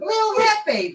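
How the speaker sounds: put-on high shouted rat baby squeak. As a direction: yell it as a tiny rat baby. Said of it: "I'm the baby she just gave birth to".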